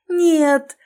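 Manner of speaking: high, loud scream; frantic scream. Acted. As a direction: cry out in pain. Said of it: "неистово закричала". (неистовый крик)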